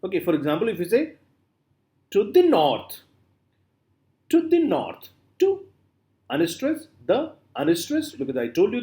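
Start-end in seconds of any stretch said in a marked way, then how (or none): 2.1-5.1 "to the north" with the weight on a high NORTH; light "to the", then hit "north"; stress on NORTH. Shown as a demonstration. Teacher showing students an anapest, stress on NORTH. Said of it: "first two syllables are unstressed".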